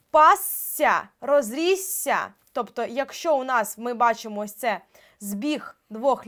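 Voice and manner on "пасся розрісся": пасся і розрісся повільно, з довгим «сс»; протягни «сс» у словах «пасся», «розрісся»; подвоєне «с». Shown as a demonstration. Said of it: "і в нас є постфікс". (довге подвоєне «с» у «пасся», «розрісся»)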